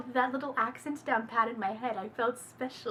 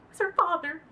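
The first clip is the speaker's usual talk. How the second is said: ordinary talk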